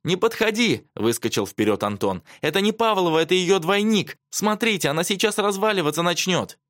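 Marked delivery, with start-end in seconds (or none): 0.0-0.9 high, urgent cry; agitated, high-pitched exclamation; alarmed warning. A narrator's reading. Boy jumps forward to warn. 2.4-6.6 high, urgent exclamations; agitated voice pitched right up; frantic warning. A narrator's reading. Boy frantically warns the others.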